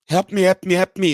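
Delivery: a put-on desperate shout for help; mock scream for help. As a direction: yell help me in a hammed-up panic. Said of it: "you just scream".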